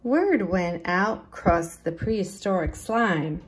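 usual speaking voice

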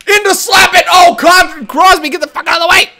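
high, loud, exasperated shout; frantic yelling. Real, not acted. Gamer to Crosby blocking his shot, frantic yelling. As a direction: yell urgently at a teammate in the way. Yells at teammate during play.

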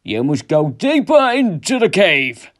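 loud, drawn-out ghostly summons; father's voice. A narrator's reading. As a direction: command him as a ghostly father. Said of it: "it sounded like his father".

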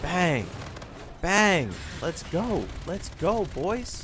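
high-pitched hype calls; frat bro. Real, not acted. Hype after kills.

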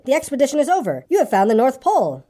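a touch of solemnity. A narrator's reading. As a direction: announce it with measured importance. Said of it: "said Christopher Robin solemnly".

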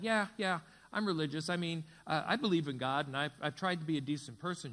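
ordinary talk

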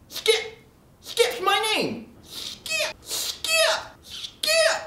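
silly high-pitched name chant; squeaky name calls. Acted.

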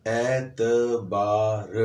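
एतबार drawn out slowly in four separate pieces, ए-त-बा-र; slow, separated syllables on एतबार. Shown as a demonstration. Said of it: "कितने टुकड़ों में उस वर्ड को बोला गया है".

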